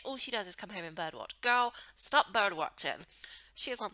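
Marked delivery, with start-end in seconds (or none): none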